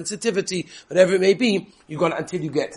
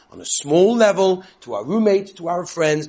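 emphatic; 5 semitones higher, 6 dB louder than usual. Real, not acted. Preacher urging thanks to roommates and friends, emphatic. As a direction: lean into each item.

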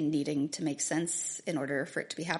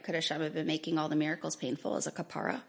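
ordinary delivery

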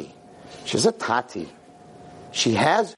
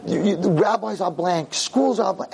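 higher-pitched mock tirade; cursing; 6 semitones higher, slower than usual. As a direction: imitate a girl hurling curses. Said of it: "And she starts cursing me".

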